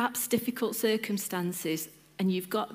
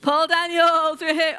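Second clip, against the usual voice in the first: calling out; 7 semitones higher, 8 dB louder than usual. Real, not acted. Host relaying an audience member's famous person, calling out loudly. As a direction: belt the name out to the whole room.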